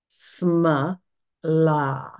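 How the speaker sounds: sma, then la with a breathy end; syllable demo. Shown as a demonstration. Teacher breaks the word into syllables for students. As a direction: split it into sma and la. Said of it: "there's the bit".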